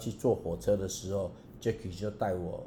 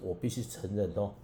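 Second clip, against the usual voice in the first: normal talking voice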